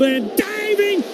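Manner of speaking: high, rising play-by-play yell; excited shout. Real, not acted. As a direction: call the dive as it happens. Live call of diving catch.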